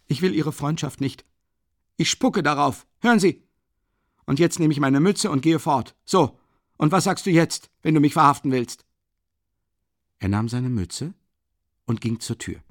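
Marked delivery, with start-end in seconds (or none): 0.1-8.8 high, loud defiant outburst; angry defiance. Acted. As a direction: throw it in his face. Raskolnikow defies Porfiri angrily.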